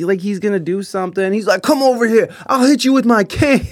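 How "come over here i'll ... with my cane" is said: raised, higher-pitched blustering threat; hammed-up tough talk; mock threat. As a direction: imitate an empty threat from a big talker. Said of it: "he makes all these threats".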